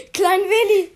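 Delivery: high, loud cry of recognition; drawn-out excited yell; excited shout. Real, not acted. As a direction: shout it as a sudden happy rediscovery. Suddenly remembers forgotten show figure.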